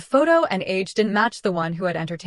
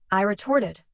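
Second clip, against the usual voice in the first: ordinary delivery, nothing marked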